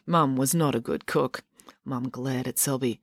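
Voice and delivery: ordinary delivery, nothing marked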